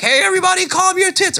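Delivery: put-on high-pitched call to the crowd, no accent; theater director calling out. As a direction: call a rowdy audience to order. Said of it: "Or, sorry, French accent".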